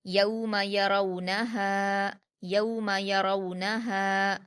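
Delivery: यौम यरौनहा slowly, smooth au glides, long final हा; soft waw glide. Shown as a demonstration. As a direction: glide gently through यौ and रौ, hold हा in यौम यरौनहा. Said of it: "मारूफ आवाज़ के साथ नर्म पढ़ेंगे".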